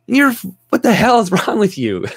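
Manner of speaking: high, loud mock scolding; mock outraged yell. Mocking a teacher who scolds a shy child, mock yell.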